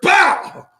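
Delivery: high, loud punch cry; mock punch sound. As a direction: shout the impact like a hit lands. Mimicking a punch landing.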